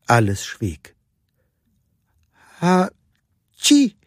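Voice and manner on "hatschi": high two-part achoo; baby elephant sneeze. A narrator's reading. Baby Jumbo sneezing out last feathers, high sneeze. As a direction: squeak out a sneeze after a short catch. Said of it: "machte Baby Jumbo und nieste".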